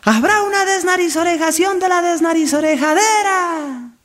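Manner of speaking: high, wailing, overacted tongue twister; dramatic tongue twister. Villager recites a twister to the echo, high wail. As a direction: cry it out high like a melodrama. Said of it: "Cogió el segundo paisano y dijo".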